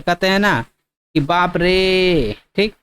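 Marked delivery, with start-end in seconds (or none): 1.2-2.3 बाप रे with the रे stretched very long and slow; drawn-out exclamation. Shown as a demonstration. Teacher stretching बाप रे for students, slow and drawn out. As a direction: drag out the last vowel of रे. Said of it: "तीन गुना समय तक खींच रहे हैं".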